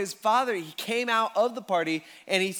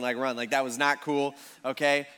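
usual speaking voice

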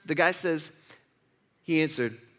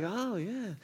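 softly murmured approval, well under speaking level; hushed crowd; 10 dB quieter than usual. Acted. Crowd hushing down to hear Jesus, hushed. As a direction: drop to a hushed aside, as a listening crowd. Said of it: "Everybody probably hushes down a little bit".

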